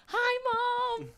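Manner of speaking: very high, drawn-out greeting to mom; retold shout-out. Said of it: "so I shouted out my mom".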